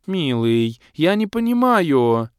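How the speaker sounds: high, slow whiny complaint; pouty offended girl. A narrator's reading. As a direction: pout it out, hurt and petulant. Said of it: "обиженно надула губы". (обиженно, капризно и высоко)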